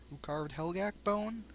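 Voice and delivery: ordinary delivery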